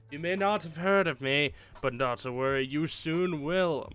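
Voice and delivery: theatrical evil mastermind voice; panto villain. Acted. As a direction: ham it up like a cartoon villain. Reads villain's email in voice.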